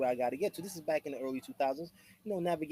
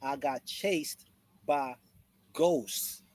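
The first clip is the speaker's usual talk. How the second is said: slow spooked retelling; 3 semitones lower, much slower than usual. Retold or imitated. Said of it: "He said".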